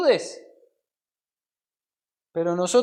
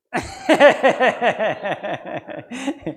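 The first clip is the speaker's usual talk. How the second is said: laughing; 3 semitones higher, 4 dB louder than usual. Real, not acted. Preacher amused by his mother-in-law's mother-in-law, laughing. Joking about in-law naming.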